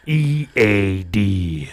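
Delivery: very slow spelled-out letters; slow announcer parody. Pretend. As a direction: draw each letter out like a logo. Slow drawn slogan parody.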